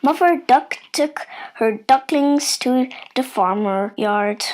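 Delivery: plain reading voice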